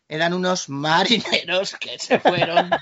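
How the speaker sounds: slow, exaggerated storyteller voice; mock solemn. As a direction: draw it out like a teacher. Mock-serious joke storytelling.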